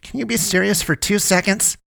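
high-pitched exasperated demand. A narrator's reading. Poe scolds Damien for his jokes, exasperated. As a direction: plead high for him to stop joking.

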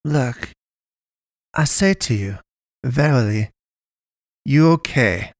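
put-on a strange girl reassuring in odd grammar; lightly voiced odd foreign girl. Pastress calming Naomi, stilted foreign cadence. Pastress speaks broken English.

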